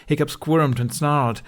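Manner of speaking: usual speaking voice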